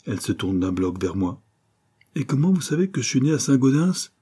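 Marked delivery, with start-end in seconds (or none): 2.1-4.1 sharp, suspicious question pitched higher; sour tone. A narrator's reading. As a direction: snap it back at him, sour and wary. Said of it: "demande-t-elle d'un ton aigre".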